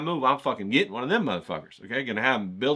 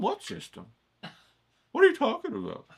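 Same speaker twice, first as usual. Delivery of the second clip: much higher, squeaky mock confusion; mock baffled viewer. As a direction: play a viewer who's totally lost.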